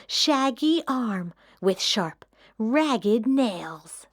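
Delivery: usual speaking voice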